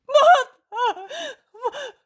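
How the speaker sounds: very high, loud broken cries; sobbing stammer calling mother; choking sobs. Acted. John cannot say Jesus is condemned.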